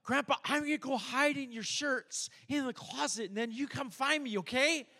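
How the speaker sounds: put-on high, eager little child pleading with grandpa; child's high voice asking grandpa to play; grandkid voice. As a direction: sing it up high like a small grandkid. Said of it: "they'd say".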